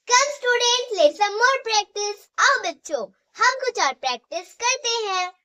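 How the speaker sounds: loud and high, calling the class to practise; rallying call to class. Real, not acted. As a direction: cheer the students on to the next exercise. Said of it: "teacher rallying class".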